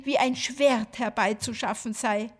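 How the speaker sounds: ordinary delivery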